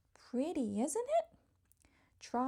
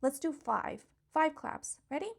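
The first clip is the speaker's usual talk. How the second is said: ordinary delivery, nothing marked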